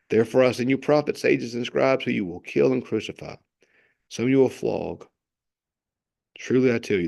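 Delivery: solemn scripture reading. Acted. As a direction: read the woes with solemn gravity. Reads scripture aloud.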